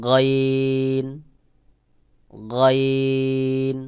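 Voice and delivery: গইন as one round throaty sound, very drawn out; correct rounded গইন. Shown as a demonstration. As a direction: hold a long গইন with a hollow first sound. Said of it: "গইনের আওয়াজটাও গোল মতো করে".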